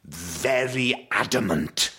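Welshman putting on posh, very slow; dad's posh voice. Retold or imitated. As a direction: stretch the words like an adamant, posh Welsh dad. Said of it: "puts on a rather posh voice".

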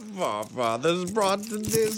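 put-on a light Borat-style accent. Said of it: "you turned into Borat by the end".

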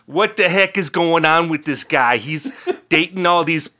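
hammed-up Wisconsin sports-talk caller; Herb from Pulaski. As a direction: sound off like an exasperated Wisconsin fan calling in. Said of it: "Herb from Pulaski".

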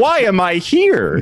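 high, loud, slow exasperation; exasperated protest. Retold or imitated. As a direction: plead in mock outrage. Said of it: "And I said".

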